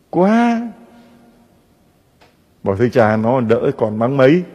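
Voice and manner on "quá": mock exclamation. Exclaimed reply in a quarrel.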